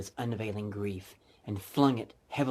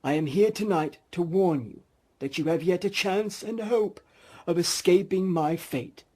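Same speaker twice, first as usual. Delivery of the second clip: lightly higher, louder solemn ghost; 8 semitones higher, 8 dB louder than usual. Acted. Marley's ghost warning Scrooge, solemn and a bit higher. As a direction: warn him gravely.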